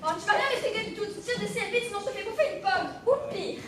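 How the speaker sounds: furious threat. Acted. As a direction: threaten him with a poisoned apple.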